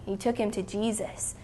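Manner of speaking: ordinary delivery, nothing marked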